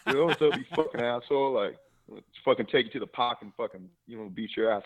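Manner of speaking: hammed-up Boston tough-kid cursing; Boston accent. Six-year-old James threatening Aaron, mock Boston accent. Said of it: "a Boston accent".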